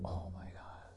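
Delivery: breathless whispered gasp of awe; hushed, starstruck whisper; awed whisper. Retold or imitated. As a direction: whisper it like a smitten girl. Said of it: "The women, they're like".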